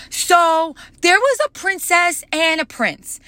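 high put-on little-girl voice telling a story; little girl storytelling. The three-year-old telling her story under the bleachers, high child voice. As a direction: play a small girl starting her fairy tale. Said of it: "She was like".

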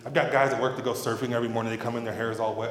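normal talking voice

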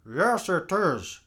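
usual speaking voice

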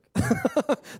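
amused chuckle at own pettiness; burst of laughter. Real, not acted. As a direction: laugh at yourself. Laughing at own petty anger.

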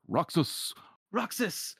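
high mock yells back and forth; restrained acted yelling; yelling his name. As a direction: call the name out, pitch climbing. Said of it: "yelling his name back and forth".

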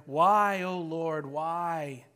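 a light whiny complaint to God, a touch angry. Retold or imitated. Pastor imitating a sulking believer, lightly whiny and angry. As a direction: mildly whine it out like a sulking child. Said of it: "I can kinda duplicate this whiny, angry voice".

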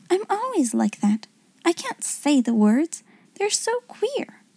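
put-on high, hesitant little-girl voice; a hint of a self-doubting child; lightly voiced schoolgirl. A narrator's reading. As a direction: confess it like an embarrassed schoolgirl, high-pitched. Dull girl voiced in story, embarrassed.

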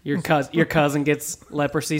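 ordinary delivery

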